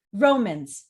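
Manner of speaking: "Romans" loud on RO, falling away; first-syllable stress. Shown as a demonstration. Teacher shows stress, "Romans" hit on RO. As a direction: punch the start of "Romans". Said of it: "it's stressed at the very beginning".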